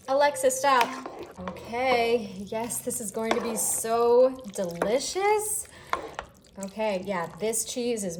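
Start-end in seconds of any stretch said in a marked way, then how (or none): none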